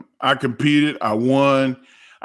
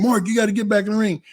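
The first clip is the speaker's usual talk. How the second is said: urgent referee; 8 semitones higher, much faster than usual. Retold or imitated. Acting out the referee's urgent order.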